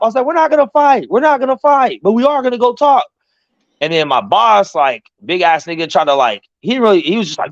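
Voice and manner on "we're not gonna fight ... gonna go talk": high-pitched insistent reply; acted heated reassurance; retold protest. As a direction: insist over the commotion, voice pitched up. Retold answer to bystanders.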